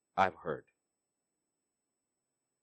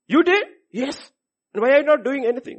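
loud, higher, fast accusing; indignant challenge; 11 semitones higher, 7 dB louder than usual. Acted. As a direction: demand answers, indignant. Acted argument with God.

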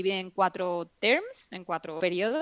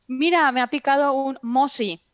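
put-on child voice; 5 semitones higher, 6 dB louder than usual. Child quoted about mosquito bite.

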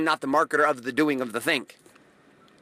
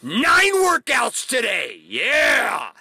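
overeager mock hype; 5 semitones higher than usual. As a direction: play the overexcited newbie promising too much. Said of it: "they're like".

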